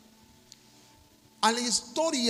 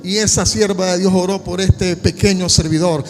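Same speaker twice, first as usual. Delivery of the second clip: predicando a gritos, con fervor; predicación con fuerza; 8 dB louder than usual. Acted. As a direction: clamando ante la congregación. Preaches the story at full force. (predicador que clama con fervor ante la iglesia)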